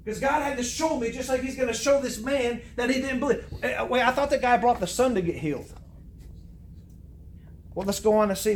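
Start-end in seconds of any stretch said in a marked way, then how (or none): none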